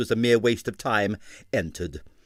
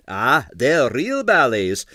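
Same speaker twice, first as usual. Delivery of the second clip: gloating; 2 semitones higher, 6 dB louder, slower than usual. Acted. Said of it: "said the Balletto Medico gloatingly".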